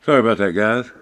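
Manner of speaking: out of breath from chasing the dog; panting, winded apology; gasping for air. Real, not acted. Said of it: "Sorry, I'm out of breath".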